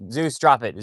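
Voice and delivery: loud, firm order to the dog; firm command. Real, not acted. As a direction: tell the dog off sharply. Ordering the dog to drop something.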